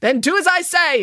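high, loud order; commanding. A narrator's reading. Nanny instructing the jogger, loud commanding order. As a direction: lay down the law. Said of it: "instructed Nanny Piggins".

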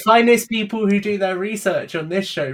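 loud, high mock-proud praise, laughing at the end; mock pride. Teasing his co-host about his research, mock-proud announcement. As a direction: puff it up as a joke.

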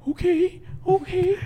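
high, frightened compliance; scared kid voice. Retold or imitated. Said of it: "And he was like".

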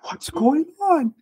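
high, slow alarmed question; wife seeing him in tears, high and concerned; wife's worry. Retold or imitated. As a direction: ask it high and stretched, startled. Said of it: "her face went from a smile to".

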